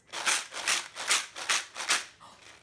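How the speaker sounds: big breathy gasp of wonder; loud surprised intake of breath; delighted gasp. Acted. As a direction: gasp as if amazed for the kids. Hears her noisemaker for first time.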